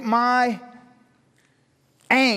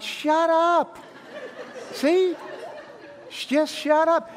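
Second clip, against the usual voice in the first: mock annoyed; 6 semitones higher, 3 dB quieter, much slower than usual. Joking reply to wife.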